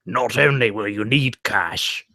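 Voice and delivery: low, slow put-on Cockney; deep, drawn-out imitation Cockney; Cockney merchant. Co-host repeats another requested line, low Cockney. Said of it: "with a Cockney accent".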